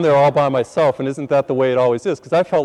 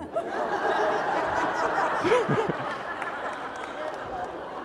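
crowd laughter; 7 dB quieter than usual. Real, not acted. Congregation laughing.

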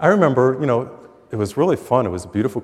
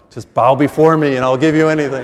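loud, booming devil offering the world; tempting devil; 3 semitones higher, 9 dB louder than usual. Retold or imitated. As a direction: boom it out like the devil tempting you. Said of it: "It's like the devil".

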